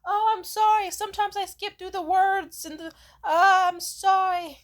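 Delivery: hammed-up tearful stammer; mock flustered apology. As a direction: parody a caught-out panicking friend. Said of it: "They were like".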